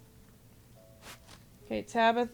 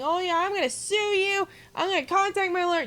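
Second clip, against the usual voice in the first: higher, louder whiny threat voice, fast at the end; mocking the troll; 7 semitones higher, 4 dB louder than usual. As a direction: parody his empty lawsuit threat. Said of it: "And yet he's saying".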